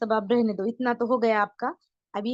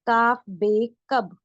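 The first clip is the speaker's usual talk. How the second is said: each letter drawn out very slowly; slow spelling out; 3 dB louder, much slower than usual. Shown as a demonstration. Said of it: "ये line पढ़ेंगे". (बहुत धीरे, एक-एक हर्फ़ अलग करके)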